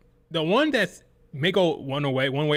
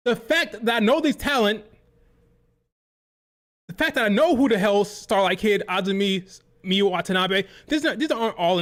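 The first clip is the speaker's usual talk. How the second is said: ranting yell; 4 semitones higher than usual. Real, not acted. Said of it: "I'm sorry that I'm yelling".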